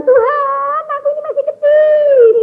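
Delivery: put-on high, tearful voice of a young girl praying; crying girl's plea. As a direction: plead like a girl crying to God. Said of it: "Dia nangis". (suara gadis menangis memohon kepada Tuhan, tinggi dan lirih)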